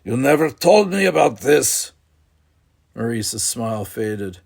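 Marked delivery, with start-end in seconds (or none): 0.0-1.9 hot, louder angry protest. Acted. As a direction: let the anger burst out at father. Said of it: "anger hot in his voice".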